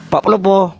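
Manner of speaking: high calling voice, calling someone over. Real, not acted. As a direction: call his name across the room. Calling out to a stream guest.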